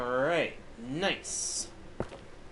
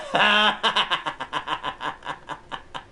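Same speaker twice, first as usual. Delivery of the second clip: laugh; 4 semitones lower, 3 dB louder than usual. Real, not acted. Cracking up at a game moment.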